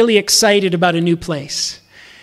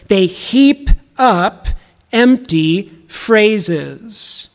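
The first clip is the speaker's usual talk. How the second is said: slow emphasis; much slower than usual. Real, not acted. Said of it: "slow quoted phrase".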